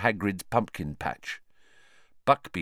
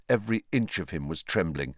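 ordinary delivery, nothing marked

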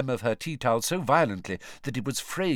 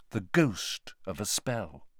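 ordinary delivery

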